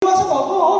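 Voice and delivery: 높고 들뜨게 부르는 흉내; 신나서 남편을 부르듯 가볍게; eager invitation. Retold or imitated. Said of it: "막 이래". (시식을 권하며 남편을 부르는 아내의 들뜬 말투)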